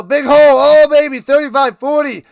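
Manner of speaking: real excited roar at a big run; excited shout. Real, not acted. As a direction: erupt as the runner breaks free.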